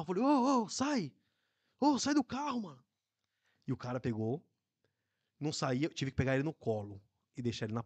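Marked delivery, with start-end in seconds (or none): 0.2-2.8 high-pitched call to get out; yelling at a passenger to leave the car; mock shout. Ordering drunk man out. (grito imitado, agudo)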